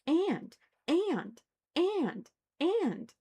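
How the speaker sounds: "And" yelped high and loud each time; mocking repetition. Shown as a demonstration. Mocking the text's endless "and", yelped high. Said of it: "Every single paragraph starts with "and".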